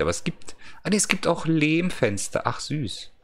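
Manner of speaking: ordinary talk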